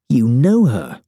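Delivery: sharp accusing question, pushed up. A narrator's reading. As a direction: confront her with it. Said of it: "I accuse".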